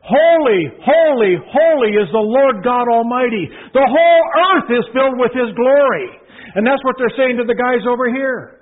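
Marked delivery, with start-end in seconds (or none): 0.0-6.2 high, loud, ringing proclamation; calling out. Shown as a demonstration. Seraphim calling to one another, loud and high. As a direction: cry it out across the throne room. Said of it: "Say it like you mean it".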